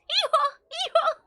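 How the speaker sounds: loud high hee-haw bray; donkey bray. Acted. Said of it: "brays like a donkey".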